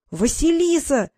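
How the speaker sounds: high, drawn-out happy exclamation; thrilled to hear a friend; overjoyed greeting. A narrator's reading. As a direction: call the name out with delight. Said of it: "обрадовался в трубке знакомый голос".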